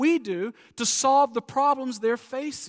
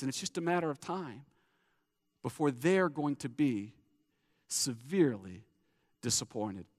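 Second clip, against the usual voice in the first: fading low and soft to a whisper-quiet end; grave, hushed warning; 8 semitones lower, 6 dB quieter than usual. Real, not acted. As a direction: let it sink in, softer and lower. Preacher fades to grave close.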